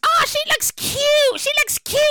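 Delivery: high, slow cooing praise; a lightly voiced doting mom; sweet mock-mom gush; imitating mother. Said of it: "my mother, my sister".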